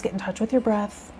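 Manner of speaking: usual speaking voice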